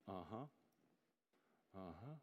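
very soft, low, half-hearted uh-huh; disengaged, token acknowledgement; absent-minded. Retold or imitated. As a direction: murmur agreement with your heart not in it. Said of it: "not really have our heart engaged".

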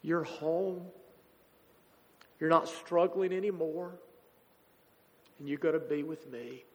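embrace him with every word; loving welcome. Retold or imitated.

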